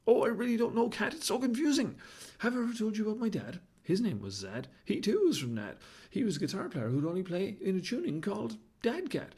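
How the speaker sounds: a scatterbrained show-off baboon, all in a flap; flustered baboon. Acted.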